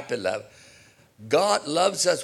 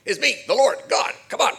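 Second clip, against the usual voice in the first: a touch of a barking sergeant, higher; half-hearted parade-ground order; mock drill sergeant; 11 semitones higher than usual. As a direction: playfully snap it like a sergeant. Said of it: "as a sergeant that says".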